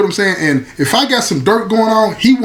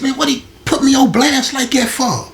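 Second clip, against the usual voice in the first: inner panic; 7 semitones higher than usual. Retold or imitated. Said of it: "I'm thinking in my mind".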